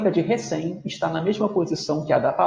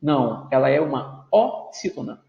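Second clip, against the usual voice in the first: ordinary talk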